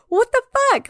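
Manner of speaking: high-pitched, incredulous outburst; mock outrage. Imitating an ex-wife answering her gloating ex, mock outraged. Acted retort in retold movie argument.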